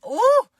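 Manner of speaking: very high, loud delighted squeal; gleeful squeal. Real, not acted. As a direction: squeal with glee at the burn. Delighted at cutting her off.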